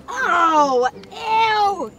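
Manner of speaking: slow, drawn-out grossed-out groans; squeamish, drawling disgust. Acted. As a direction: stretch every ew in revulsion. Finds T-Bone's mushy lunch.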